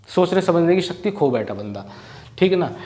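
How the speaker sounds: ordinary talk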